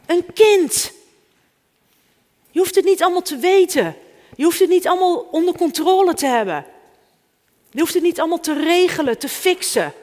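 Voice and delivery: loud, fast, high sermon opening; preaching. Acted. Preacher opens the sermon to the congregation, loud and fast. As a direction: preach it out with urgency.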